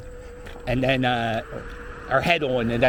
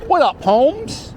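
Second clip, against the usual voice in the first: slangy greeting; 10 semitones higher, 4 dB louder, slower than usual. Pretend. Joking slang greeting on the name.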